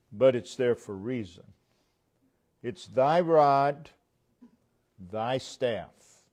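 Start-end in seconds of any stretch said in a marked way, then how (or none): 2.6-6.2 slow down and hit "thy" hard; preacher stressing "thy" to show whose rod it is. Shown as a demonstration. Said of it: "he's putting the emphasis".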